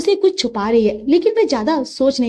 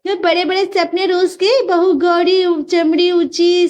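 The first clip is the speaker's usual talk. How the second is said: higher, slow mocking taunt; neighbour taunting; 7 semitones higher, slower than usual. Neighbours jeer at Sumesh in the lane, mocking. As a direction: sneer at his fall.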